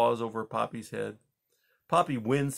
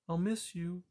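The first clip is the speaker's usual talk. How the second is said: soft, higher hushed farewell; quiet tender whisper, softer; 7 semitones higher, 6 dB quieter than usual. A narrator's reading. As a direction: breathe it out softly. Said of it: "Poppy whispered".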